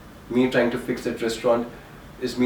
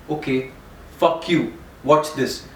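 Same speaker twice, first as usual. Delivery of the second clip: a touch of raised spite, pushed out; defiant fuck-you; 3 semitones higher, slower than usual. Retold or imitated. Recalling his defiant reply to the brother who cut him off, spiteful. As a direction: throw it back at him, stung and defiant.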